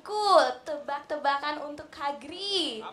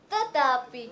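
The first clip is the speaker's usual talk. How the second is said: ordinary talk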